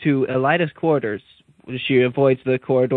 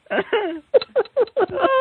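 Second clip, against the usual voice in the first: high squealing giggle. Real, not acted. Laughing at spoiler temptation.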